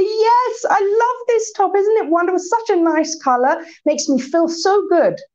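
breezy confident delight, no offence taken; cheerful reply. Retold or imitated. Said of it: "a position of strength looks like this".

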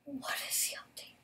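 whispered question. Real, not acted. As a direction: ask it under your breath. Repeats question under breath.

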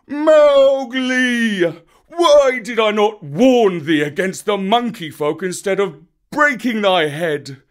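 high, loud, despairing wail; wailing bear. A narrator's reading. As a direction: wail in misery for the lost cub.

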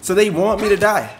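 usual speaking voice

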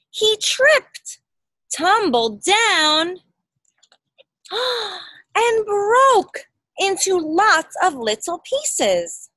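high, loud dramatic fall with a gasp; dramatic telling. A narrator's reading.

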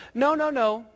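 put-on high, quick little-girl protest; lightly acted bossy sister; Sally objecting. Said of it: "And Sally goes".

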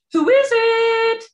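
very high, loud little pig; high piggy call through the door; squeaky pig voice. A narrator's reading. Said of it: "called the first pig".

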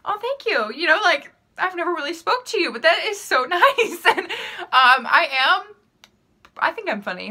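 high, delighted reply to a compliment; flattered surprise. Retold or imitated. Retelling her reply to the classmate's compliment, high and delighted. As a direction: gush back, thrilled and caught off guard. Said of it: "And I was like".

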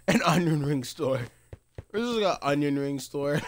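ordinary talk, nothing marked

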